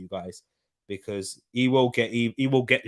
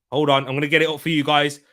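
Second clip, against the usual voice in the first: ordinary talk, nothing marked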